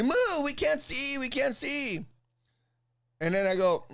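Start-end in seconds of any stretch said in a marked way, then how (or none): 0.0-2.0 high, stretched-out whine imitation; mock nagging woman. Spectator complaining she can't see, high nagging. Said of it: "And she".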